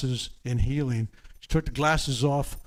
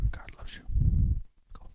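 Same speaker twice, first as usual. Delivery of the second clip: soft unvoiced whisper; whispered blessing; 3 dB quieter than usual. Real, not acted. Healer whispers a blessing to a kneeling seeker. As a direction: breathe it close like a secret. Hushed blessing during healing.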